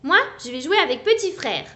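put-on childish declaration; shouting. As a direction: announce it loudly to everyone. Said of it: "crie Alistair".

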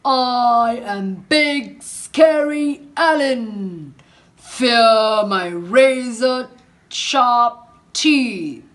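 slow, loud monster boast; scary crocodile boast. Acted. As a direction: puff up and scare them, slowly. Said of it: "He said things like".

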